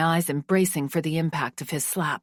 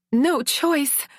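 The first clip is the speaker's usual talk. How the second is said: cried out; 9 semitones higher, 3 dB louder than usual. Acted. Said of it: "I cried".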